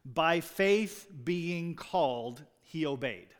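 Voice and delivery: By faith being called spoken very slowly, the word faith lifted; Greek word order, emphasized. Shown as a demonstration. Preacher showing the congregation the Greek emphasis, slow and deliberate. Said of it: "translated directly would be this".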